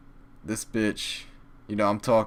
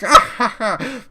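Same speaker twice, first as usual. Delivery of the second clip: mock laugh; 11 dB louder than usual. Mocking their laughter.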